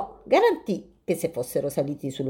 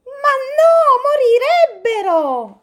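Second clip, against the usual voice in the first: much higher, louder alarmed cry; exclaiming; 7 dB louder, slower than usual. Acted. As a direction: stop him before he picks them. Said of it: "esclamò Tartaruga".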